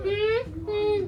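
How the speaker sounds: two-beat closed-lip hum; wordless demonstration hum; humming the word. Shown as a demonstration. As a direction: hum out each syllable. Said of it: "Hum it".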